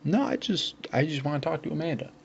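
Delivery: imitated high-pitched, innocent protest; innocent plea. Innocent plea when blocked on stairs.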